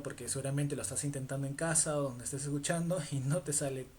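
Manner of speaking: normal talking voice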